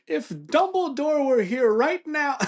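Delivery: very high, slow mock British proclamation; drawn-out theatrical British outburst, pitched sky-high; British accent. British-accented guy stands up mid-debate, grandly indignant. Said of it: "the guy with the British accent".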